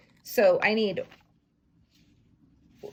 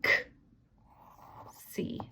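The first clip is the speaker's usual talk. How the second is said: whispered letters; 9 dB quieter than usual. Shown as a demonstration. Teacher whispering letters to her class. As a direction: whisper the letter sounds softly.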